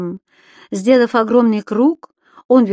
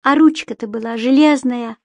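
usual speaking voice

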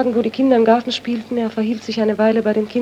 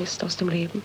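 faltering whisper; 4 semitones lower, 11 dB quieter than usual. Acted. Witness recalls dead husband.

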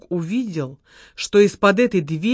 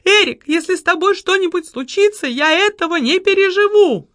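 мольба; 9 semitones higher than usual. A narrator's reading. Секретарша умоляет, кашляя.

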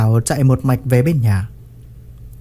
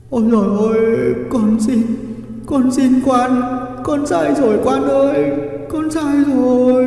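high, wailing plea for mercy; tearful begging; 10 semitones higher than usual. A narrator's reading. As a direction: beg as if under the lash. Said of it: "kèm theo tiếng khóc van xin".

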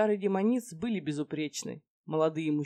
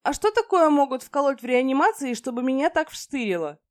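high, loud, blurted question; 9 semitones higher, 9 dB louder than usual. Acted. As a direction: blurt it out for everyone. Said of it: "громко задала вопрос".

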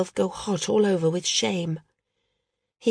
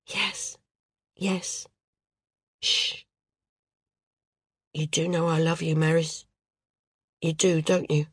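soft soothing; 5 dB quieter than usual. Acted. Dorrel soothing Maris tenderly.

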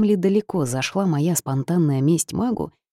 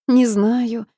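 sniffling; 7 semitones higher, 4 dB louder than usual. A narrator's reading. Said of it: "всхлипнула я".

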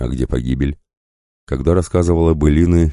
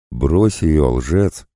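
plain reading voice

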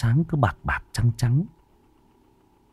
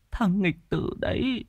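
frightened whisper; 11 semitones higher, 6 dB quieter than usual. Acted. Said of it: "Bà Lành nắm chặt tay Khiêm".

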